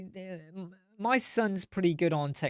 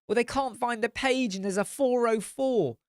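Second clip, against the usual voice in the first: exasperated explaining; 8 semitones higher than usual. Retold or imitated. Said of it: "she'll be like".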